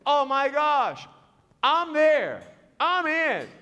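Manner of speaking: loud, high burst of enthusiasm; excited. Retold or imitated. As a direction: jump at the chance, each phrase a cheer. Acting out his excited decision.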